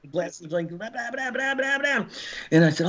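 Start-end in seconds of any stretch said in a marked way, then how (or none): 0.7-2.1 mock gunfire noise. Imitating gunfire on glasses.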